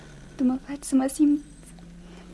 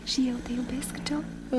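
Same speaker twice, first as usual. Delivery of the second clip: soft whispered confession; 9 dB quieter, slower than usual. Acted. Love confession. (mărturisire șoptită)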